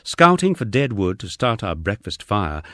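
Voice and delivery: usual speaking voice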